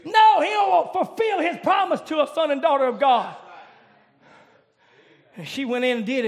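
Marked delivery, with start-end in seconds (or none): 0.1-3.3 loud, rising sermon cadence; fiery revival preacher; preaching at full force. Acted. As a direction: preach it hard to the congregation. Preacher building to a climax.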